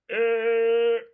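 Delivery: 으 as a long, loud L hum; tongue pressed hard. Shown as a demonstration. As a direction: hold the L in 으 against the palate. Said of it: "입천정에 세게 붙었다가".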